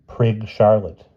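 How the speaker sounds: usual speaking voice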